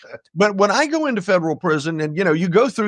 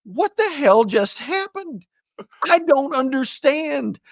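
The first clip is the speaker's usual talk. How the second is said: slow, higher confused voice; dazed and lost, drawn out; mock bewildered rookie; 9 semitones higher, slower than usual. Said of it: "I'm just standing there like".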